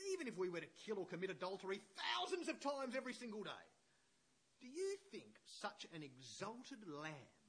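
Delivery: high, loud preacher's declamation; impassioned quote reading. Acted. Preacher reading Luther's letter to church, impassioned declamation. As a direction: declaim it with rising fervour, leaning on key words.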